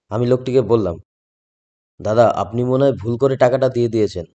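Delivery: usual speaking voice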